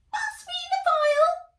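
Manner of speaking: very high, loud squeaky guinea-lion voice; squeaked. A narrator's reading. Guinea lion asking the robot for the file, high loud squeak. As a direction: squeak it out like a tiny creature. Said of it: "squeaked Scorter".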